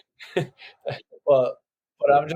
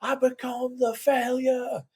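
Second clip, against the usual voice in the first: lyric belted much higher like a crowd screaming it; mock crowd singalong scream, pitched way up; crowd scream imitation; 11 semitones higher, 4 dB quieter than usual. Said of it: "everyone just screams that".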